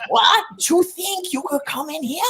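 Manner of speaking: high-pitched, hammed-up Cuban accent; mock-indignant challenge in a put-on Cuban accent; Rod Steiger's Cuban accent. Movie fan mocking an actor's Cuban casting, hammed-up Cuban accent. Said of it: "in what world is Rod Steiger Cuban".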